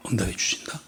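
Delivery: low, soft whisper. Real, not acted. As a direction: whisper the promise to the congregation. Climax of sermon point. (낮게 속삭이며)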